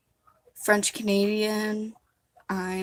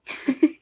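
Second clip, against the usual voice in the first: short laugh; 6 semitones higher, 7 dB louder than usual. Real, not acted. Laughs at her Viking idea.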